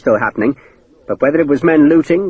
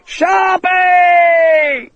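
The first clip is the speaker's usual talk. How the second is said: much higher, long wailing yell; desperate shout. Acted. Hakeswill alone with the snakes screams for Sharp, desperate long shout. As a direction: scream his name for help. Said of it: "he shouted".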